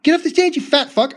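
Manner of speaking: high mocking shout; mock shrill yell. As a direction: shriek it like an angry harpy. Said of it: "yelling harpy".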